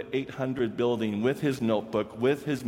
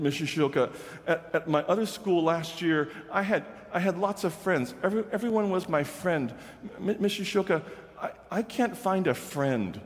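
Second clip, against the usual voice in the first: higher, stammering boy's voice, imitated; retold boy stuttering in a higher voice; pleading student; 7 semitones higher than usual. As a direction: voice Travis's halting, eager plea. Teacher voices struggling student Travis.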